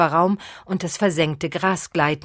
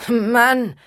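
higher, louder scornful echo; sneering; 7 semitones higher, 5 dB louder than usual. Acted. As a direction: spit the word back in scorn. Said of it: "höhnte der Vampir".

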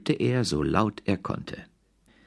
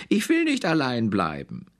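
higher, whining little bear; whiny cub; 8 semitones higher than usual. A narrator's reading. The cub begging not to be left alone, whining high. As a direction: plead like a sulky cub. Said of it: "brüllte der kleine Brüllbär".

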